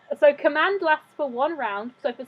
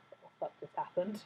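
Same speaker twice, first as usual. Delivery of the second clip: very soft, low bewildered mutter; dazed muttering; 6 semitones lower, 15 dB quieter than usual. Acted. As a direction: mutter it under the breath, baffled. Guard's dazed reaction, acted by GM.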